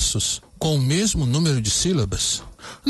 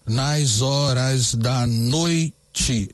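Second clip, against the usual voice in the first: very slow, every syllable spaced out; measured scansion read; syllable counting; much slower than usual. Shown as a demonstration. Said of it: "Vamos contar essas sílabas".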